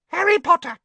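very high, loud squeaky cry; high-pitched creature. A narrator's reading. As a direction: squeal it out at the top of your range. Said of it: "in a high-pitched voice".

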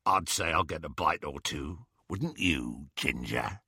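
low, soft leering taunt; leering werewolf. Acted. Greyback to Ron, low soft leering taunt. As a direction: taunt him with relish.

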